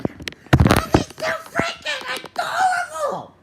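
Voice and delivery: very high, shrieking and laughing; ecstatic squeal. Pretend. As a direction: squeal with wild joy, slowly at first. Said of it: "she's like".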